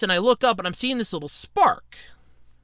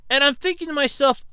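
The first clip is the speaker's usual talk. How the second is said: normal talking voice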